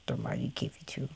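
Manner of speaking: whispered in reverence for the tank; awed whisper. Real, not acted. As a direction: breathe it out in hushed awe.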